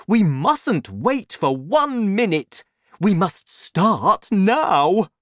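high, loud, slowing to hammer the end; rally them to act at once; urgent excited cry. A narrator's reading. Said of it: "cried Mister Cranky".

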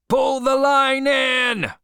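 high, loud, long-drawn shout; cried out. A narrator's reading. Boat leader to seamen hauling the line, shouting. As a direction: holler it slowly to the whole boat. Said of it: "cried Stubb".

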